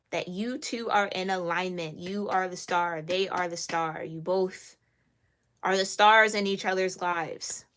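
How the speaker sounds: ordinary delivery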